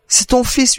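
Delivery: high, anxious plea of a mother; trembling voice. A narrator's reading. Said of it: "lui dit-elle d'une voix tremblante". (voix tremblante, suppliante)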